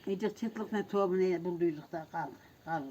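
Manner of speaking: speaking without teeth; toothless, gummy speech. Real, not acted. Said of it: "ama dişlerim yok".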